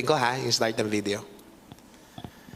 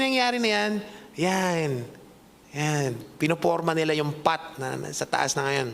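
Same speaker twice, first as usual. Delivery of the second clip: ordinary delivery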